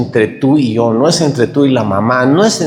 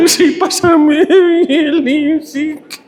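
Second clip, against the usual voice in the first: much higher, sing-song through laughter; high giggling sing-song; laughing; much slower than usual. Retold or imitated. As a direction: stretch the name out while giggling. Still laughing giving name.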